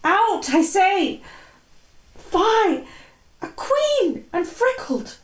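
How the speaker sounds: sharp outcry. Acted. Sleepwalker's outburst.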